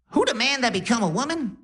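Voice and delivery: put-on very high, brash Def Jam comedy accent; grandma's crazy accent. Grandma on morphine greeting her grandson, high brash comic accent. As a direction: imitate a Cat Williams style accent. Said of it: "talking in a crazy accent".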